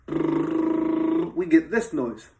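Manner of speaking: high steady lip buzz. Shown as a demonstration. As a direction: buzz the lips like a trumpet player. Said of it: "we need to make a buzzing noise with our lips".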